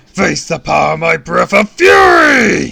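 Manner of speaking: very loud, high villain roar; booming villain shout. A narrator's reading. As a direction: roar it like a comic-book villain. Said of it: "he boomed".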